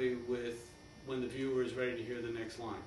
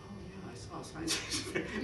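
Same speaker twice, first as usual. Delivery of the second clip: laughing realization; 7 semitones higher than usual. Shown as a demonstration. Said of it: "it's just sort of free association".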